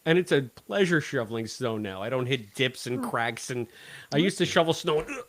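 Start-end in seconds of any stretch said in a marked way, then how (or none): none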